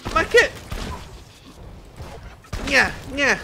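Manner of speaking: very high, loud nasal-free taunt gne gne; mocking whine. Real, not acted. Player taunting the enemies with a high mocking gne gne.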